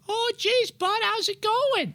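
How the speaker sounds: very high, drawn-out squeaky Mainer; put-on high-pitched Maine local. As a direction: imitate a Maine buddy in a high falsetto. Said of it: "would do this voice".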